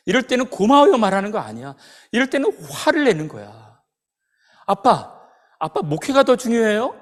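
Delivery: loud, high, exasperated scolding; angry outburst. Retold or imitated. Father showing his injured son how to get angry, indignant and loud. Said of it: "확 화가 치밀어 오르더래요".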